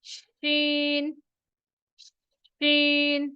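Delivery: slow model "शीन"; letter demo. Shown as a demonstration. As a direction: draw out the sh of "शीन". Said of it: "शीन भी जीम की तरह".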